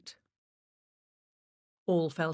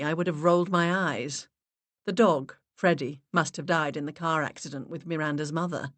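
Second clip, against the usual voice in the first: ordinary delivery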